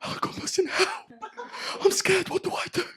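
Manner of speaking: half-whispered, teary imitation of a scared boy; mock crying kid. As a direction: play the frightened nephew begging, whimpering. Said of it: "Starts crying down the microphone like".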